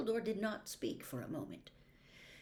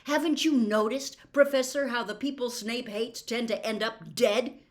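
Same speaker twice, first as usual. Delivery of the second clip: loud, higher, scornful shout; bitter yell; 5 semitones higher, 10 dB louder than usual. Acted. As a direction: throw it at him bitterly. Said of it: "a yell of mirthless laughter".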